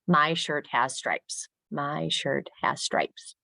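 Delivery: slow, evenly separated words; measured teacher model sentence; word-by-word example. Shown as a demonstration. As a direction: say it slowly so each word can be counted. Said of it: "have the kids count the number of words".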